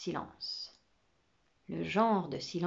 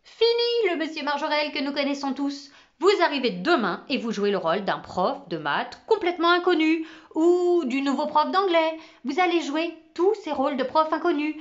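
higher, louder enthusiastic pitch; eager pitch; 6 semitones higher, 5 dB louder than usual. Acted. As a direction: sell the plan with enthusiasm. Camo enthusiastically pitches idea.

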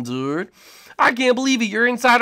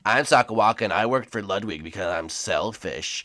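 low, soft Sakawaka voice; deep, quiet skeleton impression; 13 semitones lower, 6 dB quieter than usual. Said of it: "that Sakawaka impression".

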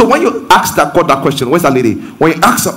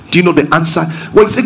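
normal talking voice

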